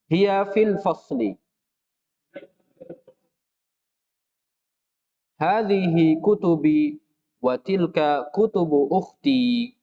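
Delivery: slow, careful Arabic reading for students; slow drill reading. Shown as a demonstration. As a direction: read each sentence slowly and clearly for the class. Reads drill sentences. (membaca kalimat latihan pelan-pelan)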